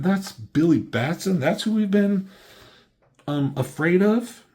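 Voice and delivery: a hero can't believe who they feared; shocked disbelief. Retold or imitated. Heroes realising the kid was the threat, shocked and high.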